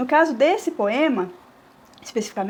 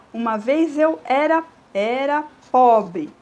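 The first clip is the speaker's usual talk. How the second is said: "pobre" with pó stressed and bre almost dropped, slow; scanning the verse; 4 dB louder, slower than usual. Shown as a demonstration. Teacher scans the verse, stressing the syllable. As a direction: stretch out "era pobre", lean on pó. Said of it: "o pó do pobre é uma sílaba forte".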